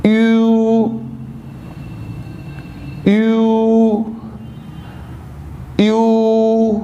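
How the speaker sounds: یو said as a drawn-out "yoo"; yu sound. Shown as a demonstration. Teacher modelling the sound for students, drawn-out "yoo". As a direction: hold the "oo" in یو. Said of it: "تو اب یہ اب sound".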